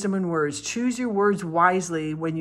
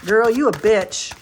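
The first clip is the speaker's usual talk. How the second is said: put-on louder, slow, blunt girl you a bitch; blunt mock insult; 4 semitones higher, 8 dB louder than usual. Tarot reader mock telling a friend off bluntly, loud and slow. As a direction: drop it bluntly like a bossy friend. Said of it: "I'm gonna be like".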